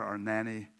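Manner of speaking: ordinary delivery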